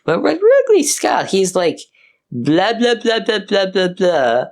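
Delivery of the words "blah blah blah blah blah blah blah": high, nasal-free mock drone of blah blah; droning old man. Mocking the director as a droning old man lecturing. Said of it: "He's like an boring old man giving, like, an old man lecture".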